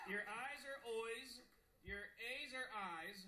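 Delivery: EYES turned into "oys", A'S into "I's"; Australian vowel demo. Shown as a demonstration. Teaching the crowd Australian vowels, "eyes" as "oys". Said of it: "Here's what I've learned so far".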